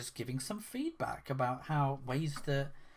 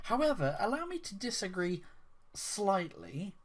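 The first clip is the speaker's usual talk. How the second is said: high, prim and overly courteous; mock polite; 5 semitones higher than usual. Mimicking the fan's polite reply on Twitter, mock polite. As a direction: pitch it up like a fussy polite objector.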